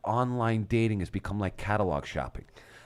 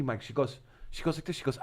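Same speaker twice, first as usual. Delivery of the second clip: ordinary delivery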